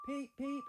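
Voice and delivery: high, loud whistle call; engine whistle peep. A narrator's reading. Little engine Edward whistling proudly as he sets off, high whistle peep. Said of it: "he whistled".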